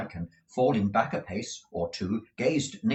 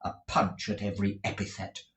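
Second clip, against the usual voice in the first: usual speaking voice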